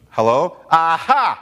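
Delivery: loud, pouncing "Aha!"; retold gloating. Atheist phoning the preacher about a scandal, mock gloating. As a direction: act out a triumphant gotcha.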